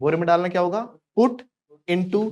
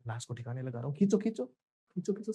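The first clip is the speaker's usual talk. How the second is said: hushed urgency; 9 dB quieter than usual. Pretend. Accomplices dragging a body away, hushed urgent play-acting. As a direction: urge a helper softly while pretending to haul.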